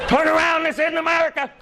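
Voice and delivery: high, loud panicked cry; panicked foreigner. Retold or imitated. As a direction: yell it high as if fleeing. Foreigners seeing statue.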